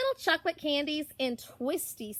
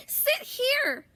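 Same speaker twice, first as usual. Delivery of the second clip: eager call; 12 semitones higher, 3 dB louder than usual. Acted. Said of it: "they say".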